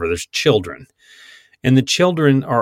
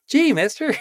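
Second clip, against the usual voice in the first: put-on high, wide-eyed Mickey Mouse Club kid; chirpy cartoon child, pitched way up; gee-whiz kid. Disney child actor gushing at grown-up, high and chirpy. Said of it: "everybody's like".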